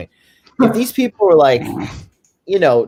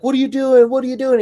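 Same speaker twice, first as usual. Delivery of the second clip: high, incredulous mocking question; lightly voiced panicked onlookers; a hint of shocked crowd asking it twice; mock alarmed bystanders; 13 semitones higher, faster than usual. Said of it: "they're like".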